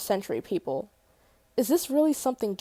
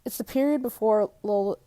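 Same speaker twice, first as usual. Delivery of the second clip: ordinary delivery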